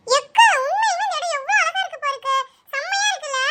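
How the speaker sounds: put-on excited child. Child admiring the place.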